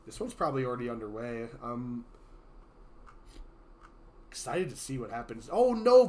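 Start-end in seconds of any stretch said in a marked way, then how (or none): 5.5-6.1 sudden high "oh no"; surprised. Real, not acted. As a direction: react with shock to the forfeit. Player suddenly forfeits.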